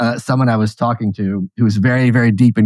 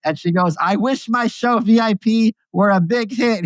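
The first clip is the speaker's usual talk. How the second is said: Gilbert Gottfried; 10 semitones higher than usual. Acted. Comedian telling a genie joke at a roast, in high impression. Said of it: "I'll even do the voice".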